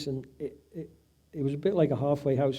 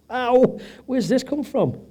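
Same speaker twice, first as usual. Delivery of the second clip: surprised; 9 semitones higher, 5 dB louder, slower than usual. Retold or imitated. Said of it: "I'm like".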